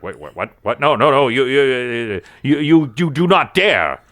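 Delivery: stammering, rising in pitch and volume; sputter, then build to outraged refusal; alarmed protest. Acted.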